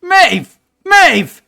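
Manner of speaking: loud, high yells of Maeve; shouting up. Acted. Father yelling upstairs to his wife, loud shouting. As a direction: bellow up the stairs. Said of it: "like, shouting up".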